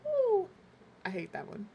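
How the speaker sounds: usual speaking voice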